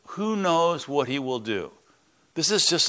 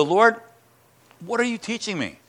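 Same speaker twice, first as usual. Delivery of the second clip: higher, pleading with God again; a frustrated believer asking God once more; more exasperated plea; 8 semitones higher than usual. Retold or imitated. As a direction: push the question up, tired of waiting. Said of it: "Then later I would go".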